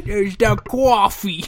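slow, exaggerated New York coffee; mock New York accent. Co-host echoing the coffee line in mock New York. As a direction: drag out the New York vowels. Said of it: "a New York accent".

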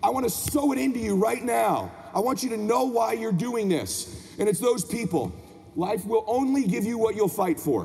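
high, driving motivational sermon; motivational preaching. Acted. Speaker challenging parents to be their children's hero, impassioned preaching. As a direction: drive each phrase home like a preacher. Motivational speech at full force.

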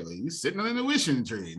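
usual speaking voice

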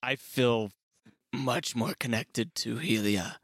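slow, soft, solemn confession; hushed reverence. Acted. Champion clutching Helia's handbag, hushed reverence. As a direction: say it quietly, with reverent pauses. Solemn devotion to a goddess's relic.

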